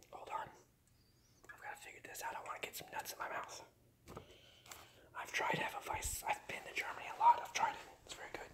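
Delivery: soft unvoiced whisper. Real, not acted. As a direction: hush it so nobody wakes. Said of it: "I don't wanna wake them up".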